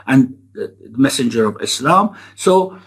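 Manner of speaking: ordinary talk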